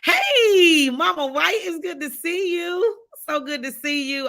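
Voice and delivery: high-pitched happy hello, loud at first; excited greeting. Real, not acted. Host welcomes a beloved viewer to the stream, excited greeting. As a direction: sing out a long hello, then soften fondly.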